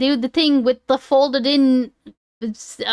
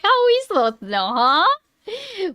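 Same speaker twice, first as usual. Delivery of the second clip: ordinary talk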